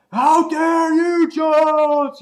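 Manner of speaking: drawn-out, high furious mock shout; hammed-up freak-out yell; enraged Cyclops. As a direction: bellow it slowly like an outraged comic-book villain. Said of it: "Cyclops all of a sudden is pissed off".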